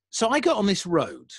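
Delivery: ordinary delivery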